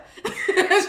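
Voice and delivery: high, loud burst of laughter; real laugh cracking through. Real, not acted. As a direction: break into laughing. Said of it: "She like".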